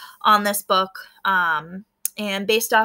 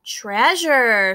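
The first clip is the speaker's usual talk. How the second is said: excited louder shout of discovery; 6 dB louder than usual. A narrator's reading. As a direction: cry out at the find. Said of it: "shouted Chemo".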